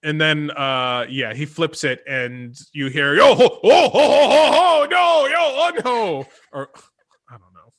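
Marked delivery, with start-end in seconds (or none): 3.1-6.3 high, very loud wailing yo-ho; hammed-up pirate cries of dismay; unhappy pirates. Game master plays pirates as the switch flips, unhappy yo-hoing. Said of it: "exclamations of unhappy yo-hoing from the pirates".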